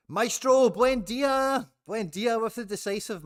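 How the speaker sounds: high, loud celebrating yell; excited shout. Real, not acted. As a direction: cheer it like your player just delivered. Fan player earns the decisive bonus.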